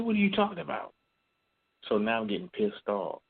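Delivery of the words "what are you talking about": high incredulous question to father. Retold or imitated. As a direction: demand an explanation, baffled. Confronting father about money.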